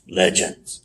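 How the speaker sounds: low, soft, dismissive sarcasm; sarcastic. Retold or imitated. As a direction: mimic a wrestler's sarcastic greeting, low and quiet. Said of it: "sarcastically say".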